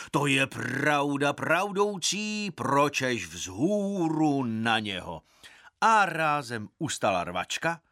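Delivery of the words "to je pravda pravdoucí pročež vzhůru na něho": slow, drawn-out put-on deep voice of a maharaja. A narrator's reading. Maharaja rouses the crowd against the boy in a slow deep voice. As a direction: intone it ponderously, stretching the words. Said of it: "odvětil hlubokým hlasem".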